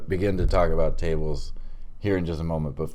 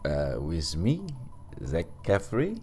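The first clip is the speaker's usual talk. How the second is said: put-on French accent; mock-French host intro; 4 dB quieter than usual. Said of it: "End of accent".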